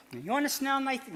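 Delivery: ventriloquist's puppet teasing; high puppet tease. Acted. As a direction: puppet pushing its stinky feet. Puppet teases about feet.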